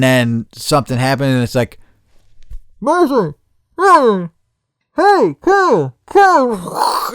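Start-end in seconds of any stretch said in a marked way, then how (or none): 2.8-7.1 high-pitched distant-sounding call. Retold or imitated. Friend calling someone out of a daydream, high mock call.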